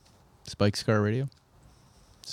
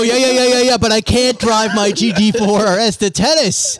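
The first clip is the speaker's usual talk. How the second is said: dismissive whiny; 7 semitones higher, 4 dB louder than usual. Retold or imitated. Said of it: "I go".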